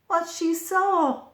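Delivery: ordinary delivery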